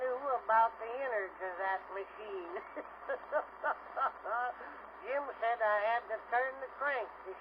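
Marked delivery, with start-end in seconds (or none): 2.6-5.0 string of short staccato chuckles; a storyteller's punctuating laugh; comic chuckle. Acted. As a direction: chortle at your own joke. Laughs at his own joke.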